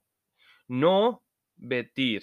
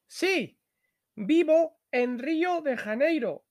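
much higher voice for the character Ana; Ana's voice; 12 semitones higher than usual. Acted. Teacher reading Ana's lines to the class, high pitched.

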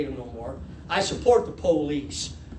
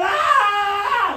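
high, loud mock scream; long hammed-up cry of alarm; yelling; 14 semitones higher, 5 dB louder than usual. Runaway flailing in the river, mock yell. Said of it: "and I'm yelling".

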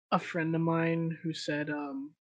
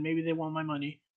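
ordinary talk

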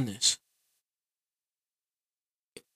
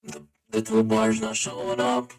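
robotic vocoder with stepped, locked notes; 7 semitones higher than usual. Real, not acted. Player stuck as a vocoder, wanting the voice back, vocoder voice. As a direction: voice run through a vocoder effect. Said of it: "I just wanna switch my voice back".

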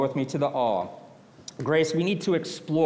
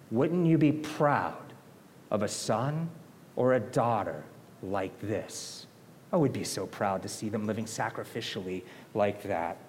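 ordinary talk

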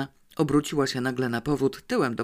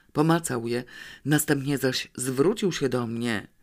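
ordinary delivery, nothing marked

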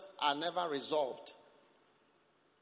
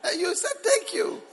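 very high, loud mock reply; mock concession; 6 dB louder than usual. Preacher acting out a quarrel over thanks, high and loud. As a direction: pitch it right up in playful argument.

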